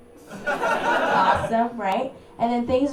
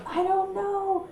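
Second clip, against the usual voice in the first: mock innocent; 7 semitones higher, 4 dB quieter than usual. Playing innocent to police.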